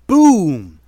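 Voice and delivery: explosive, drawn-out high boom. A narrator's reading. Storyteller as the crown rips off, booming. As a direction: burst out loud on the word, then let it fall. Dramatic sound word in story.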